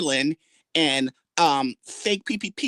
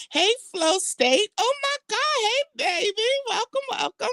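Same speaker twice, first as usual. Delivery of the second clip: gushing welcome. Real, not acted. Greeting viewers joining the live.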